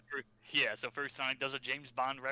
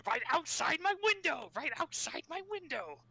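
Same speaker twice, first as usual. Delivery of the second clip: high, squealing cartoon villain; Robotnik voice. Repeating Robotnik YouTube Poop line.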